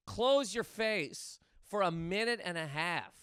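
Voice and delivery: normal talking voice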